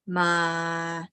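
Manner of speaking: sustained "ma" held low; low pitch. Shown as a demonstration. Teacher showing three pitches, low held "ma". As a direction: hold "ma" at the bottom, level. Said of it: "low pitch".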